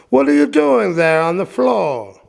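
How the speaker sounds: high, loud storybook Geppetto. A narrator's reading. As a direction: call out the question in a lifted voice.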